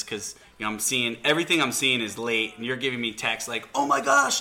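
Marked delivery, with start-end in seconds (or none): 3.7-4.4 high, loud mock exclamation; imitated overexcited outburst; excited exclamation. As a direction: voice a friend's frantic excitement, pitched way up. Voicing friend's excited texts.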